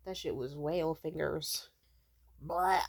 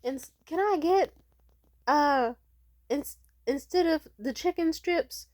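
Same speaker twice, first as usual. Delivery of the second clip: put-on drunk re-order; 9 semitones higher, 10 dB louder than usual. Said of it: "I was still kinda drunk".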